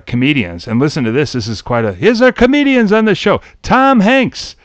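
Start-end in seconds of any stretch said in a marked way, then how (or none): 1.9-4.5 put-on high New York-accented emcee roll call; pitched-up, projected host introduction in a New York accent; Bobby Van. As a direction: announce each name like a New York show host. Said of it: "And when he introduces".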